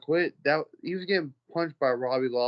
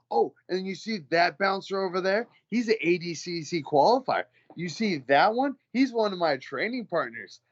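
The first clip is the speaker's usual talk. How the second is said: higher, pointed mock-casual boast; retold one-upping; 7 semitones higher than usual. Bouncer introducing fighter colleagues to boaster, smugly one-upping. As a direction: point out each ally, pitched up.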